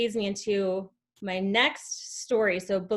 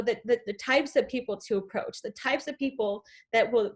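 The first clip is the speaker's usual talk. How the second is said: ordinary delivery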